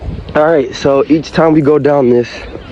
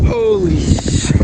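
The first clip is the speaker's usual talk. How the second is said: surprised; 6 semitones higher, slower than usual. Real, not acted. Hit speed on sled.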